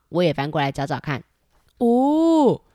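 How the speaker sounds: usual speaking voice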